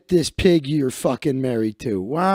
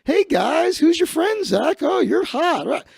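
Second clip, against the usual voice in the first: much higher, eager butting-in voice; barging-in friend; 10 semitones higher than usual. Retold or imitated. Chris the cockblocker barging in on a friend's flirting, much higher and eager. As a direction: crash the conversation with a squeaky high greeting. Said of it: "he would barge in".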